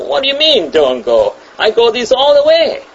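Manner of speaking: high, scoffing, boastful retort; cocky dismissal. Retold or imitated. Proud driver scoffing at the fog warning, high cocky retort. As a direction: brush off the warning with pride. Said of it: "he heard this and said".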